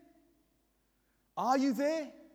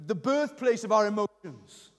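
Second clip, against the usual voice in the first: usual speaking voice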